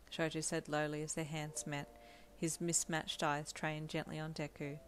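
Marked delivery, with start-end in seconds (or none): none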